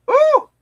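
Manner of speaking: loud, high-pitched ooh. Real, not acted. Friend imagines falling from the ride, loud high ooh. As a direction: let out a sharp high whoop of dismay.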